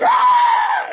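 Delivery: very high loud shriek; shrieking in acted fright; mock fright scream. Acting out viewer's fright.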